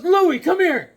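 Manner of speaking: high, loud call across the shop. Retold or imitated. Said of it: "He goes".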